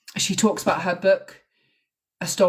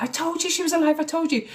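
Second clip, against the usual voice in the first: much higher, elated cry of vindication; excited scream; 10 semitones higher than usual. Retold or imitated. Mum hears on the phone her daughter is alive, screams high in joy. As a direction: mum shrieking that she was right all along. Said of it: "And she is screaming".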